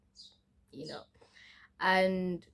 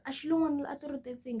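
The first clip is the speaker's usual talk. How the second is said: higher, quick mock taunt; mimicked jeering; 7 semitones higher, much faster than usual. Schoolmates at the tuck shop gossiping about her, mimicked jeering. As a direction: imitate schoolmates calling out a tease, fast and higher.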